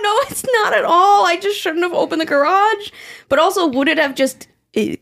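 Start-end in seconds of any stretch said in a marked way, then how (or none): 0.0-2.9 high, urgent reassurance; reassuring sister. Retold or imitated. Comforting her sister during the flood, high and urgent. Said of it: "I was like".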